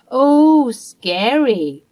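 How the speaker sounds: sarcastic high "Oh", then dismissive; mocking. Mother-in-law mocking Mia's threat, sarcastic and loud. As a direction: sneer at the threat like it's a joke.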